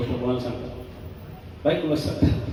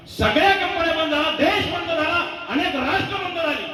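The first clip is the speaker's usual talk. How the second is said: high, ringing preacher's cry, slowing on each phrase; preaching at full force. Acted. Kirtankar rousing the audience about the lockdown, soaring preaching cry. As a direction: lift the crowd with a soaring sermon call.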